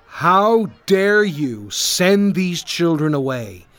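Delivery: slow, loud, indignant rebuke; angry scolding. A narrator's reading. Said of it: "he scolded his disciples".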